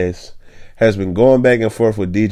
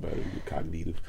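villain voice; 5 semitones lower, 17 dB quieter, much faster than usual. Pretend. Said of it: "That sound like a, uh, villain".

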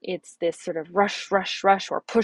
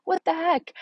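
sudden higher "what the heck?"; exasperated surprise; 10 semitones higher than usual. Retold or imitated. Mom retelling her morning kitchen cleanup, mock exasperated outburst. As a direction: jump up in mock disbelief. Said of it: "I'm like".

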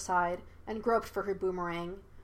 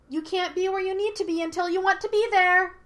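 put-on high, raised childlike voice; monkey spirit calling after; 11 semitones higher, 6 dB louder than usual. A narrator's reading. Monkey spirit calls after departing Yue, high-pitched. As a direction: call it out, climbing high.

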